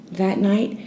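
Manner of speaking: usual speaking voice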